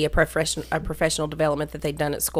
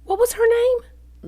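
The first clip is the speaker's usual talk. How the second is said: high-pitched; 3 dB louder, slower than usual. Real, not acted. Eager interruption.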